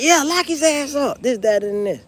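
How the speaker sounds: high, loud angry demand; yelling woman. Retold or imitated. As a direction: shout it at the officer. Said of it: "she like".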